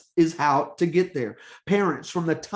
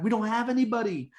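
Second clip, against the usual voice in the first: higher, quick mock complaint; retold protest in a raised, hurried voice; leaders' complaint; 6 semitones higher, faster than usual.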